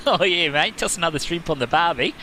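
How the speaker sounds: put-on Australian accent on a cliché. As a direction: play the cliché Australian bloke. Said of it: "I can do stereotypical sayings".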